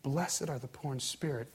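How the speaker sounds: low, soft, compassionate blessing; warm, compassionate. Acted. Jesus looks at you up close, warm compassion. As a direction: say it softly, straight to one person. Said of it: "and the warmth in his voice".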